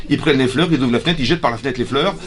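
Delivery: ordinary talk, nothing marked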